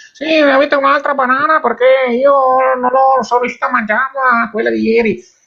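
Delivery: high female caller voice; squeaky woman calling the radio; over the phone. Acted. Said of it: "abbiamo Federica in collegamento".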